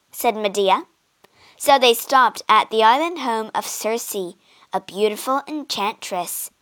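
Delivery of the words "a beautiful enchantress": plain reading voice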